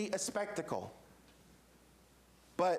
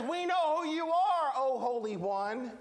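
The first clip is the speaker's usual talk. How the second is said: raised high-pitched cry, then falling; demon's outcry; 5 semitones higher, 3 dB louder than usual. Acted. Demon confronting Jesus in the synagogue, crying out. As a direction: call it out high, then draw out the title. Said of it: "And the demon says".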